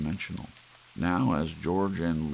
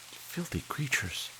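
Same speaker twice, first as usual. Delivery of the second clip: much higher, softer, with disgust; disgusted wife; 10 semitones higher, 4 dB quieter than usual. Acted. Wife disgusted by vultures overhead, high soft voice. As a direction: wrinkle your nose at the vultures.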